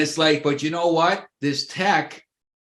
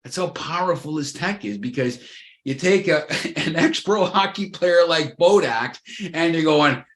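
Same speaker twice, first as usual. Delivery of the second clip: ordinary delivery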